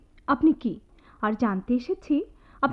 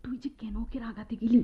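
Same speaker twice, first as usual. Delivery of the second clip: whisper; 3 semitones lower, 8 dB quieter than usual. Acted. Friends gossip in whispers during class, hushed whisper.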